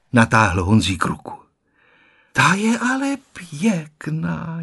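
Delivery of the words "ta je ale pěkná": slow, wondering grandma; marvelling. A narrator's reading. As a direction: gently marvel at the marble. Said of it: "divila se babička".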